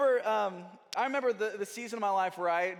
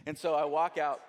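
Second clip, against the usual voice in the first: ordinary talk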